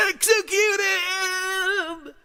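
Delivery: very high, drawn-out theatrical shriek; screamed movie order. Acted. Film ruler whose lover got killed, shrieking an order. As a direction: wail the command, stretching it out loud.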